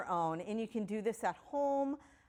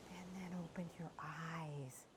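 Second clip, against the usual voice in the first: soft whisper, no voice; 4 semitones lower, 13 dB quieter than usual. Real, not acted. Teacher softly ending the visualization in a whisper. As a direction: gently whisper them back awake.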